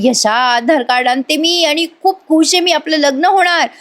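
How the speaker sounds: pleased, lifted voice; happy. Acted. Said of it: "नमी खुश होते".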